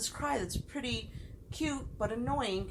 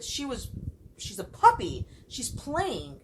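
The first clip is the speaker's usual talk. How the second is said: retold heated reply; 5 semitones higher, 4 dB louder, slower than usual. Retelling her own heated reply.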